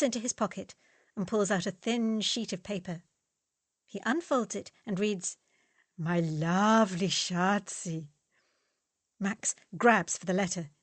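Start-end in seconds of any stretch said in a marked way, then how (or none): 6.0-8.1 slow, drawn-out mock tender reading; mock romantic reading. Fritz to Max, reading his love letter in mock romance. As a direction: savour each word to mock him.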